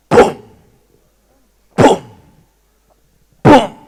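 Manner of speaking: booming stomp noise, each hit loud; mock giant footsteps for the audience. Spider's footsteps imitated.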